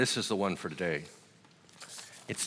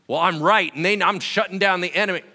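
mock righteous shout; 9 semitones higher, 10 dB louder than usual. Mocking a self-righteous believer.

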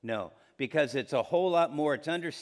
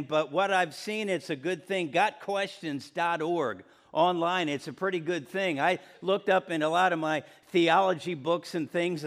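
ordinary talk

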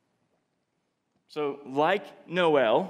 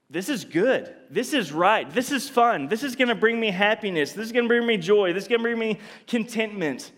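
acted rush of eagerness, higher pitch; eager delight; 5 semitones higher than usual. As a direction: gush quickly, each claim tumbling after the last. Acting out being lured by temptation.

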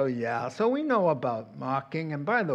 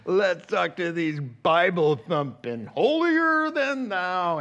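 slow, high sneering jeer at the Bible-thumpers; young scoffer taunting street preachers; mocking scoff; 4 semitones higher, 7 dB louder, slower than usual. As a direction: drag it out with contempt, pitch leaping up. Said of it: "And so I was like".

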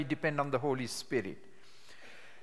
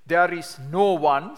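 louder and slow, stressing no; slow loud scripture stress; emphatic reading; 8 dB louder, slower than usual. Shown as a demonstration. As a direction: proclaim it, hit no. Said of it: "exactly how I do it".

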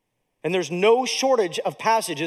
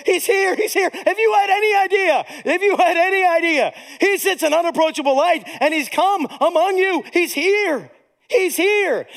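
high, excited proclamation of arrival; jubilant angelic announcement; 9 semitones higher, 3 dB louder than usual. Acted. Angels singing and clapping at the baby's birth, high and jubilant. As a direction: cry it out in amazed celebration.

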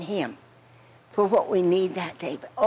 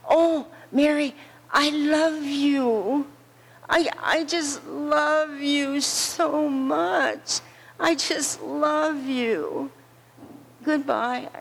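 dying friend pours her love into a last goodbye, slow and tender; 4 semitones higher, slower than usual. Retold or imitated. Dying Ella Mae tells Mary she loves her, slow and tender.

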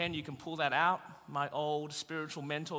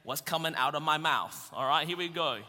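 usual speaking voice

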